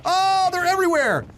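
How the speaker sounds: excited. Real, not acted. Panic in game fight.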